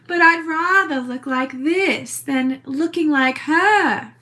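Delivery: put-on theatrical East Hampton accent; Little Edie. Showing off her cellulite to critics, in a put-on East Hampton accent. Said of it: "I love that new accent".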